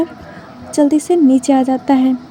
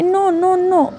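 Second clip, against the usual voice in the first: put-on childish pleading; 4 semitones higher, slower than usual. A narrator's reading. Childish son pleads for food.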